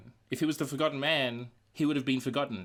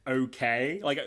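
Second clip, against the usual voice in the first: ordinary talk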